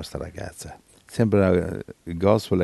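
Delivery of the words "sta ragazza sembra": ordinary talk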